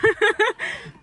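high, quick laugh; giggle. Real, not acted. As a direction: laugh it off, a bit embarrassed. Reacting to neighbours clapping.

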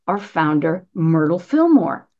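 ordinary talk, nothing marked

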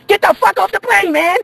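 last warning turns into a high yell; fed-up pilot screams get off; furious order. Acted. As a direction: lose it and yell him off the plane. Ordering passenger off plane.

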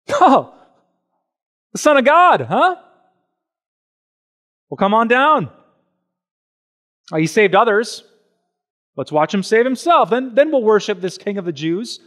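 loud, high sneering jeer; mocking taunt. Enemies at the cross jeering Jesus, loud high sneering mockery. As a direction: taunt a dying man from below. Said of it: "They're mocking him".

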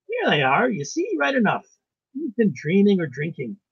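loud, high, hearty exclamation; triumphant Irish doctor. A narrator's reading. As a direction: crow that you were right, as the Irish doctor. Irish doctor triumphantly points at figures.

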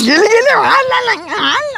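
high, whining refusal; tantrum whine. Acted. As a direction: protest in a high childish wail. Child's tantrum over the toy. (ಹಠ ಹಿಡಿದ ಎತ್ತರದ ಗೋಳು)

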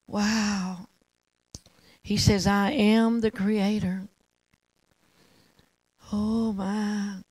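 reverent, with long awed pauses; hushed worship prayer. Real, not acted. Preacher invites listeners to honor God, hushed reverent prayer. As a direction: lead the room gently into worship, unhurried and subdued.

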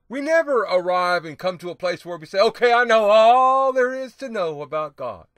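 rising to a loud, high preaching cry; preaching shout. Real, not acted. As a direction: hammer it home to the congregation. Preacher exhorting congregation.

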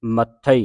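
loud ਮੱਥੇ with a doubled th; ਮੱਥੇ punched with a long closure on th; tightened consonant. Shown as a demonstration. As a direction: hit ਮੱਥੇ tightly, holding the th. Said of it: "ਕੱਸ ਕੇ ਉਚਾਰਨ ਕਰਨਾ".

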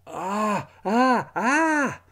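high, loud burning-mouth cries; pained shrieks. Acted. Boy yelping after drinking hot pepper sauce, shrill cries. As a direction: yelp as the hot sauce burns.

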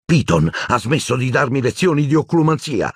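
a touch of a furious roar. A narrator's reading. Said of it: "ruggì Harry".

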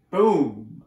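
loud, drawn-out boom; slow, heavy burst of volume. A narrator's reading. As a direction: boom it out like thunder. Narrator acts out the thunder.

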